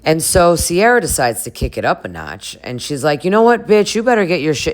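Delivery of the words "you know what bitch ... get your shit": a higher, louder 'you know what, bitch' in sassy mock anger; mock sassy telling-off. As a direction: throw it at her like a sassy friend losing patience. Sierra told off, retold.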